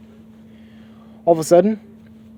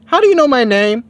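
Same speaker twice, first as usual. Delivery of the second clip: much higher and louder; confused demanding Bulma; 13 semitones higher, 7 dB louder than usual. Acted. Bulma demanding of Goku, high and loud. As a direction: press him hard for an answer.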